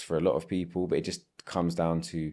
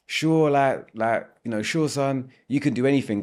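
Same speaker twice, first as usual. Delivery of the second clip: lightly voiced cheerful American parent, higher; a hint of a gushing supportive parent; encouraging parent; 6 semitones higher, 4 dB louder than usual. American parent cheering on the child's tennis dream, lightly upbeat. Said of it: "I'm not gonna do the accent".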